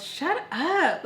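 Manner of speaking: high-pitched irritated retort; annoyed. Retold or imitated. Annoyed friend silencing her questions, irritated. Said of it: "she's so annoyed with me".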